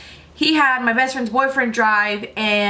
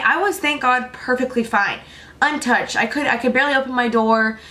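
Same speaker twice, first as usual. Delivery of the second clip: normal talking voice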